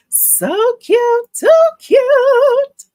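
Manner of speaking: high, loud squeal of delight. Real, not acted. Embroiderer squealing back at a viewer's compliment. Gushing over cute embroidery.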